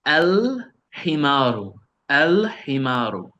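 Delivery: "El himaru" with a long, clear L; clearly sounded L. Shown as a demonstration. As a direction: hold the L before "himaru" in "El himaru". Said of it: "all of them I'm pronouncing them clearly".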